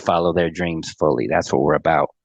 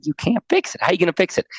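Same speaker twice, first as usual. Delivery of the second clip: doubting dad; 8 semitones higher, faster than usual. Retold or imitated.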